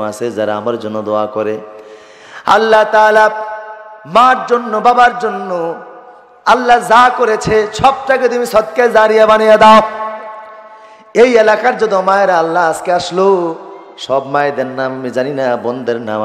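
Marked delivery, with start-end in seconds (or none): 2.5-13.5 high, loud chanted supplication; fervent prayer. Real, not acted. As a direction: call out to Allah before the congregation.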